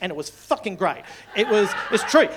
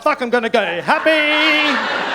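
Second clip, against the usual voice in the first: loud, high, drawn-out exclamation; gleeful yell; 5 semitones higher, 7 dB louder, slower than usual. Acted. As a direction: stretch it into a triumphant holler. Acts doomed man's gleeful last decision.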